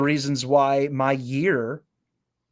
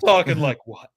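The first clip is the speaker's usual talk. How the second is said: Patrick voice; 5 dB louder than usual. Retold or imitated. Denying the voice to a puzzled coworker. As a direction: play dumb, straight-faced. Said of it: "I turned it to Patrick".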